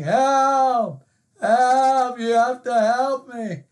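high, loud, desperate shout of help; frantic cry for help. Retold or imitated. Preacher acting the man over Niagara, frantic shouting for help. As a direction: yell it as if going over the falls. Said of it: "crying out at the top of his lungs".

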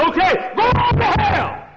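high, shouted go on to hell; preacher voicing God shouting the sinner away; shouted divine verdict. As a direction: fling it out as God's last word. Preacher voices God's verdict.

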